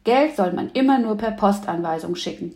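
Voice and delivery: usual speaking voice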